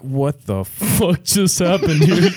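laughing disbelief. Retold or imitated.

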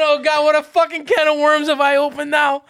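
loud, high comic exasperation; acted overwhelmed dismay. Parent overwhelmed by the questions.

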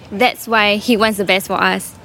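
usual speaking voice

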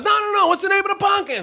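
high mock shout of the host; hammed-up yell across the room. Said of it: "And he goes".

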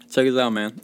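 usual speaking voice

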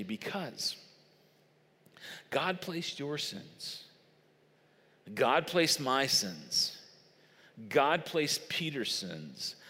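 slow, soft and grave; hushed solemn preaching. Acted. Preacher softly telling the congregation why God forsook his Son, hushed and slow. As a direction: let each phrase sink in quietly.

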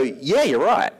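casually vain yeah; lightly voiced proud Paul; smug agreement. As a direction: accept the praise smugly. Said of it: "can I maybe just go".

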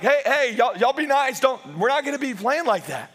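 mock scolding. Scolding older kid in story.

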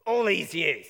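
high, loud indignant outburst; resentful son lashing out at his father; angry son. Acted. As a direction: snap back at father in protest. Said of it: "He says to his father".

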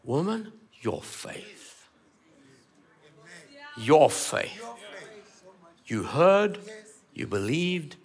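slow and very soft, fading to a whisper; hushed Jesus voice. Acted. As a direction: lean in and breathe the words gently. Jesus speaks to woman.

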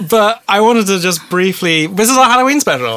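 fast, high and loud; excited. Real, not acted. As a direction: hype it up. Hyping the Halloween special.

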